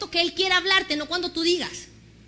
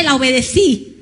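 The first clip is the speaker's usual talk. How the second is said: ordinary talk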